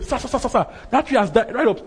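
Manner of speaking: high, loud urgent cry; excited report. Acted. Disciples calling to their master about the dead tree, excited. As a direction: rattle out the startling news, pitched high.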